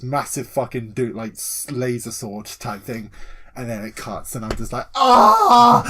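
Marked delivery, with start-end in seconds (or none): 4.9-5.8 very loud, high fanboy scream; ecstatic scream. Retold or imitated. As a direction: scream with delight, long and loud. Thrilled reaction to the laser sword.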